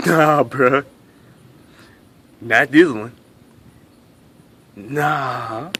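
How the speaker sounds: dismissive nah. Acted. Comic shutting down the audience, low and dismissive. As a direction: brush off the suggestion.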